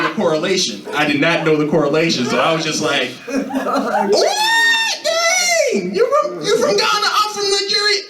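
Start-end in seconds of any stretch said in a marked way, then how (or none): 3.3-8.0 very high laughing shriek; mock surprised shout. To the Ghanaian girl, a very high laughing shriek. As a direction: shriek it out in disbelief.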